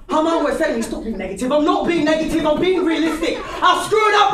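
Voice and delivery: high, loud shouted row with yourself; comic self-argument. Acted. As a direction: bicker furiously with yourself on stage. Quarrels with himself in comic monologue.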